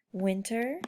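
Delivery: normal talking voice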